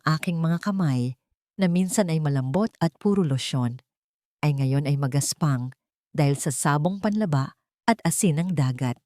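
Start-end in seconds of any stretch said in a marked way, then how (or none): none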